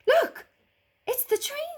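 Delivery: put-on high, loud child calling out; excited girl. A narrator's reading. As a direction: point it out as an eager little girl.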